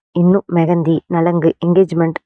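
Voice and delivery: plain reading voice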